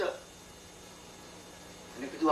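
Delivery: usual speaking voice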